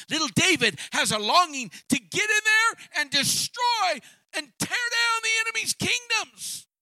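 high, loud preaching shout; preaching at full force. Real, not acted. Preacher to congregation, crying out at full force. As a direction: lift the crowd with a rising cry.

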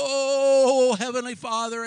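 mock showy shout to heaven; mock bellowed prayer. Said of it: "and bellow out loud".

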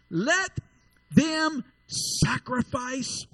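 usual speaking voice

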